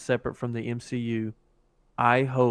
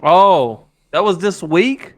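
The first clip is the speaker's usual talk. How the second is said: loud, higher-pitched disbelief; surprised; 6 semitones higher, 10 dB louder, slower than usual. Real, not acted. Co-host caught off guard by how recent the teaser was, surprised. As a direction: blurt it out in real surprise.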